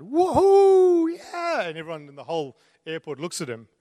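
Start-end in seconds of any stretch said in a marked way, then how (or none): 0.0-1.6 high, loud whoop of triumph; joyful shout. Retold or imitated. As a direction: whoop it out like you just won millions. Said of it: "he shouts out".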